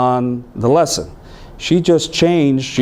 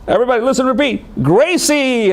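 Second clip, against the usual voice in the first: teacher calling on class; 12 semitones higher than usual. Retold or imitated. Teacher drilling the class to repeat, in a high sing-song call. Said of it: "then it comes time to say".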